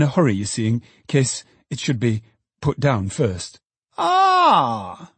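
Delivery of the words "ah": high, drawn-out groan of dismay; groaning cry. A narrator's reading. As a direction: let out a long, pained groan. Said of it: "cried Filer with a groan".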